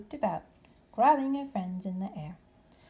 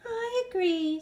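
put-on young bat; 12 semitones higher, 4 dB louder, slower than usual. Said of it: "said Stellaluna".

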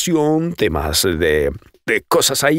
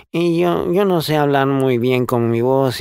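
lightly nasal, a bit higher; 5 semitones higher than usual. Shown as a demonstration. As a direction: keep a hint of the nasal demo.

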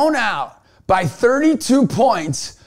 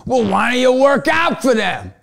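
retold shout; 6 semitones higher, 3 dB louder than usual. Urging the girls at a workout.

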